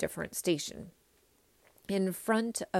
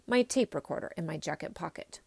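ordinary delivery

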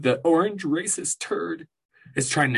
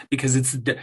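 ordinary talk, nothing marked

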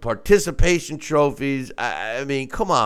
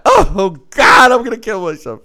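mock despair; 5 semitones higher, 6 dB louder than usual. Mocking overreaction to being called fat.

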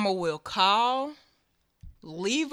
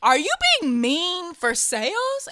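mock asking; 8 semitones higher, 5 dB louder than usual. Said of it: "I was like".

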